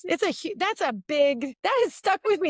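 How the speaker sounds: normal talking voice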